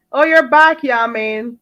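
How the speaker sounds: ordinary talk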